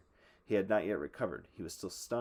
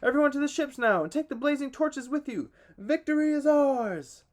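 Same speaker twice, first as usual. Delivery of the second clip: loud, high commanding cry, slowing on the last words; battle shout; 13 semitones higher, 9 dB louder than usual. A narrator's reading. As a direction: drive the army to the ships. Hector commands the charge.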